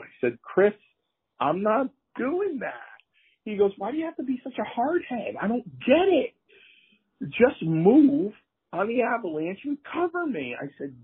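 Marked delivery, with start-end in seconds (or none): 0.4-2.7 very high, drawn-out protest; exasperated refusal. Retold or imitated. Wrestler refusing Chris's plan, exasperated and high. 3.8-10.5 very high, hammed-up nagging; exasperated insistence. Bundy pressing a stubborn friend, exasperated. Chris insisting in retold quarrel.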